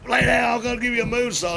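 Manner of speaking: high voiced partner mocking the order; partner's order. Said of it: "he goes".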